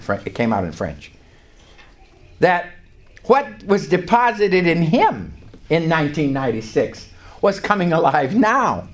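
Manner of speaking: normal talking voice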